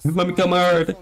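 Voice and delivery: lightly voiced silly player; mock eager teammate. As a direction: ham up the over-keen teammate. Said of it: "He's like".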